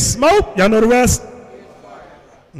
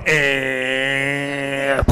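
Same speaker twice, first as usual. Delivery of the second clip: long, low droning window-motor noise; car window sound; 5 semitones lower, 3 dB quieter than usual. Retold or imitated. Rolling up the window on father-in-law, imitated drone. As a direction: imitate a window slowly winding up. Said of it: "I roll up the window".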